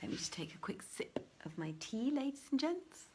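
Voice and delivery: quick, soft aside, mostly whispered. Real, not acted. Reader telling listeners she'll sip her tea, whispered. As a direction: murmur it offhand to the audience.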